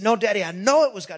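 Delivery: acted boy pressing his point fast and high; urgent insistence. Retold son insists more urgently.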